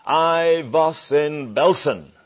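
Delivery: put-on slow, loud German-accented answer from the back. Holocaust survivor answering the preacher, loud slow German accent. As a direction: give it a heavy German accent, slow and firm. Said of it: "a voice with a German accent said".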